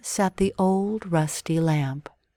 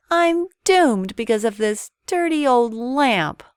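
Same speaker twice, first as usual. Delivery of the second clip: despairing; 6 semitones higher, 6 dB louder than usual. Acted. Aladdin trapped in cave.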